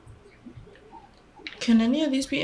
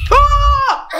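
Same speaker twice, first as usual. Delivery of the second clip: high short fright scream; startled scream; 5 dB louder than usual. Real, not acted. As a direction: scream at a jump scare. Sudden scare.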